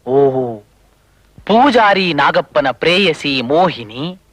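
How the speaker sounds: very slow, savoured reading; reading aloud. Acted. Man reading a love story to himself, slow reading aloud. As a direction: draw out each word of the love story.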